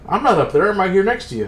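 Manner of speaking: indignant protest. Retold or imitated. Acted protest of ignored man.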